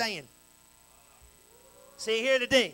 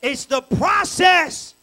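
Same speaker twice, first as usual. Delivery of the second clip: very slow, loud shout of "process"; drawn-out shout; 4 semitones higher, 10 dB louder, much slower than usual. Acted. Preaching punch line.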